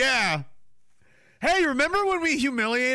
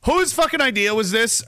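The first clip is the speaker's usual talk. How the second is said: ordinary delivery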